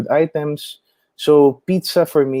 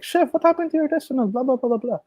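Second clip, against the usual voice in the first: surprised customer; 13 semitones higher, 4 dB quieter, faster than usual. Retold or imitated. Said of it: "text me or give me a call and be like".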